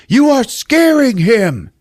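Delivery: high, loud, drawn-out scolding; mock angry yell. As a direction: yell it in protest, imitating an upset husband. Husband yells at wife.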